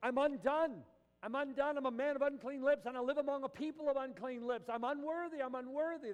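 high, loud anguished cry of unworthiness; Isaiah crying out undone. Retold or imitated. Preacher voicing Isaiah before God, a high loud anguished cry. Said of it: "he says".

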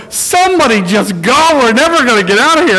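impatient driver calling out, half-joking; yelled out a window. Retold or imitated. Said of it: "I heard somebody yell out a window".

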